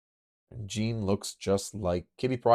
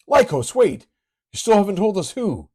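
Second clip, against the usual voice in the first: loud, higher call after him; calling out loudly; 13 semitones higher, 11 dB louder than usual. Acted. Character calls after Lycos, loud higher shout. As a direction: shout to stop him leaving.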